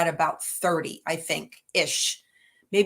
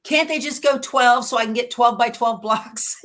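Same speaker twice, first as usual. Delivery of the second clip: mock exasperated quote; 5 semitones higher, 4 dB louder than usual. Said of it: "she's like".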